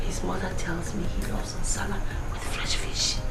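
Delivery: hushed, secretive instructions; conspiratorial whisper. Acted. Mother whispering to her daughter how to win over Fred, hushed and secretive. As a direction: whisper it so no one overhears.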